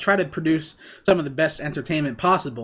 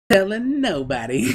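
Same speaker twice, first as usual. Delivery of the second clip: slow, laughing tease; 3 semitones higher, slower than usual. Real, not acted. Teasing secret, laughing.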